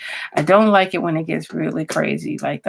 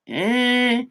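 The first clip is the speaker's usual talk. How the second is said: ordinary talk, nothing marked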